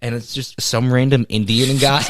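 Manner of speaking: ordinary delivery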